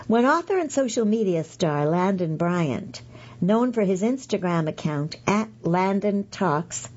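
ordinary talk

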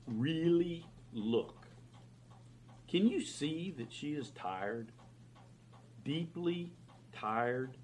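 slow, hushed sincerity; soft and earnest. Acted. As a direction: gently press the point home. Reverend speaks softly, earnestly.

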